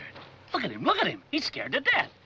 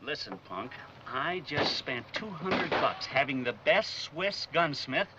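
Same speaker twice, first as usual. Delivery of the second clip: tough guy voice; 8 semitones lower, 4 dB quieter than usual. Acted. Tough-guy talk, low and slow.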